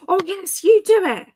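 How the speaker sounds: high, excited egging-on; delighted, high-pitched insistence; excited urging. Real, not acted. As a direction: urge them eagerly, pitch leaping up. Eagerly throws request back at listener.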